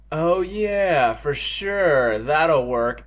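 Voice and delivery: drawn-out mock agreement; sarcastic. Boy scorning a useless suggestion, sarcastic. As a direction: dismiss it with heavy sarcasm. Evan dismisses the doctor suggestion.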